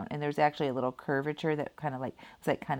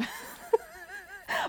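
high rising giggle; a bright giggle that rises; high laugh; 5 dB quieter than usual. Real, not acted. Laughing at the memory.

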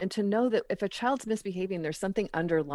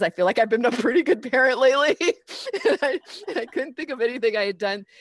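laughing at herself, higher and louder; self-mocking giggle as she speaks; 5 semitones higher, 4 dB louder, faster than usual. Real, not acted. Mother laughing at her own confidence, higher and faster. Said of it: "laughs at herself".

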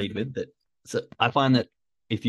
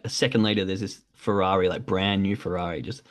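ordinary talk, nothing marked

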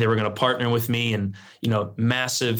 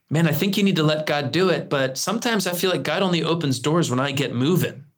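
lightly imitated Australian accent, pitched higher; 5 semitones higher than usual. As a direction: put on a hint of an Australian accent. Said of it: "deep baritone Australian accent".

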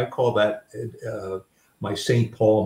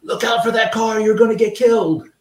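high, loud panicked warning; mock frantic yell; 13 semitones higher, 7 dB louder than usual. Grandson imitating his mom's loud, high panicked warning. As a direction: shout it like a mom who is scared for her kid. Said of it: "And he says".

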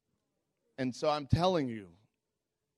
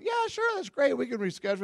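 high, sugary mock politeness; fake cheerful agreement; 11 semitones higher than usual. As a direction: chirp it back, overly agreeable and high. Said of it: "So I went".

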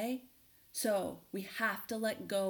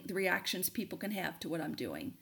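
ordinary delivery

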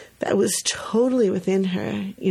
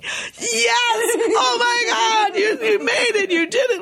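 inner cheering. Retold or imitated. Teacher cheering inside as child reaches the top, ecstatic. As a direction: cheer her on like she won a race. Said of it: "inside me, I'm one of these say".

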